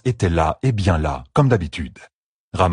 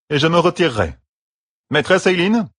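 high, raised call across the room; 10 semitones higher than usual. Acted. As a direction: call to the innkeeper. Matt calls the innkeeper.

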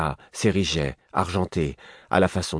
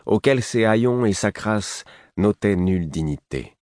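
ordinary delivery

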